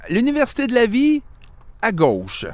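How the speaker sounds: high, sneering read of a dating profile, then a flat verdict; mocking profile read. Mocking a dating profile aloud to his cohost. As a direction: sneer the line, then swipe it away.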